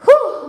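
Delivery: sharp high breathy "whew" after effort; exertion exhale. Real, not acted. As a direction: blow out hard, winded from the workout. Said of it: "I'm breathing hard".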